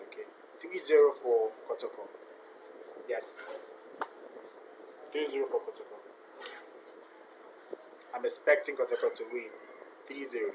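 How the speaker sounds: normal talking voice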